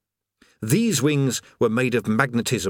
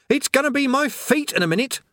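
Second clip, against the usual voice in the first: screamed. Acted. Rincewind screaming back at the chatty creator while falling. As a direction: yell it out in terror while falling. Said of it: "screamed Rincewind".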